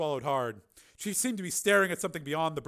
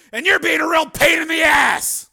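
angry threat; 15 dB louder than usual. Acted. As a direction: lay down the law. Steele threatening the man.

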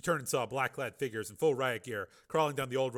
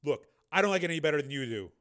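heavy; 5 semitones higher, 8 dB louder than usual. A narrator's reading. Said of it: "Volkirk said heavily".

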